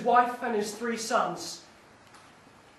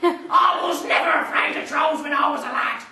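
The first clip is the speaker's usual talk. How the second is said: higher, louder mocking dad; scornful father; 4 semitones higher, 4 dB louder than usual. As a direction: scoff at the cowardly son. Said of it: "His father replied".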